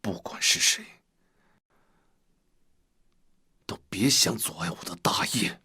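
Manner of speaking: hushed, low threat; menacing vow. Acted. Schemer vowing nobody will block the grand plan, menacing. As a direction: swear it darkly under the breath. Schemer vows alone against unknown foe.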